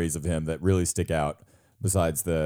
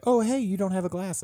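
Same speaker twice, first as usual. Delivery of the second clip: high-pitched, light retold offer; imitated friendly hostess; 12 semitones higher than usual. Turtle Wax owner offering champagne at a party, imitated in a high voice. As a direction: put on a high voice for a party guest.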